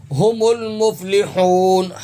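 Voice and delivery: ہم المفلحون drawn out slowly with a breathy ہ from the chest; tajweed demo of ہم; slow Quranic recitation. Shown as a demonstration. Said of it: "ہا حلق سے ادا ہوگا".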